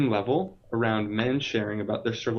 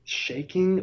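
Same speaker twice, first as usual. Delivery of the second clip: ordinary delivery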